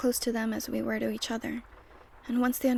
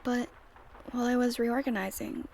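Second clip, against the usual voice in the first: normal talking voice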